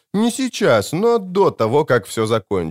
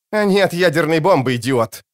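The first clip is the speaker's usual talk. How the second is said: higher-pitched bark, dropping on the last word; barked retort; 6 semitones higher than usual. A narrator's reading. Prisoner snapping at a frightened guard, a barked put-down. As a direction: snap it at someone being stupid, mildly acted. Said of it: "рявкнул Конёчек".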